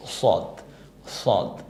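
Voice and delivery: সদ with tongue rounded at the sides, heavy; heavy sad. Shown as a demonstration. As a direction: sound the sad full and hollow. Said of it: "জিহ্বাটা যেন দুই পাশ থেকে একটু গোল হয়ে আসে". (জিহ্বা গোল করে ভারী সদ)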